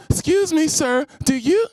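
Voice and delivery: hammed-up haughty request; mock snooty woman. Woman asking him snootily, high prim mock voice.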